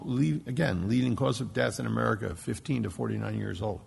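usual speaking voice

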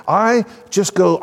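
ordinary talk, nothing marked

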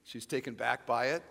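ordinary talk

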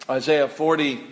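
ordinary talk

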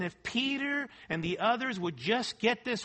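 normal talking voice

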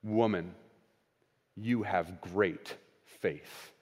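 slow, soft, low and tender; tender commendation. Acted. Jesus commending the woman's faith, slow and tender. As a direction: let the words land gently, like a blessing. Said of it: "listen to how Jesus' tone changes".

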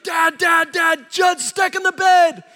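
put-on high, loud child's shout, breathless; high-pitched child shouting for dad; panicked little boy. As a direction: yell it like a scared five-year-old running in. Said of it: "like, screaming".

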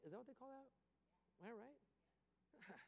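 half-whispered, checking with the crowd; asking the room whether the word is right; unsure aside. Real, not acted.

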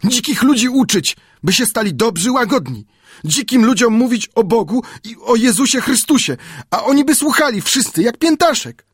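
put-on high, enthusiastic native boy; high and full of zeal, dreaming aloud; eager Friday. A narrator's reading. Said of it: "odparł z zapałem". (z zapałem, głosem Piętaszka)

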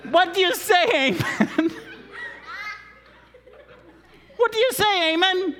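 loud, high, laughing prompt; laughing call-out. Real, not acted. Preacher calling to the boy playing Haman, laughing. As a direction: egg him on with a laugh.

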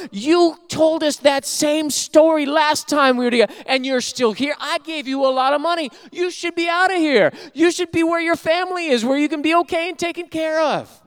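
high, loud scolding; angry rebuke. Retold or imitated. Pastor confronting the repeat beggar, loud angry rebuke. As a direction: tell the beggar off hard. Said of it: "he was just angry".

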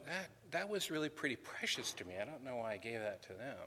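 soft, fast grumbling aside to himself; mock reluctant grumbling. Teacher voicing a giver who regrets his gift, softly grumbling. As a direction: grumble it under your breath, quickly. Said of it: "he doesn't do this reluctantly".

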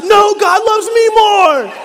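high, loud, slow laughing brag; laughing yell. Retold or imitated. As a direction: shout it out gleefully, laughing. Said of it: "he's yelling at me".

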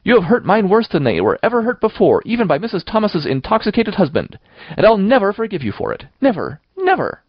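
high-pitched, wounded fury; passionate, high indignant tirade; vehement outburst. A narrator's reading. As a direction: rail at her like a deeply hurt child. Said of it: "she repeated vehemently".

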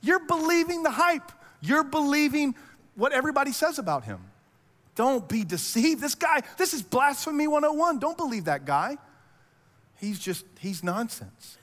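high-pitched, incredulous mock scolding; frustrated. Pharisees rebuking the officers, frustrated and incredulous. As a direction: act appalled that they fell for it. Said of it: "very frustrated".

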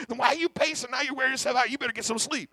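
exasperated nagging voice; scolding inner voice. Retold or imitated. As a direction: talk down to yourself like a nag.

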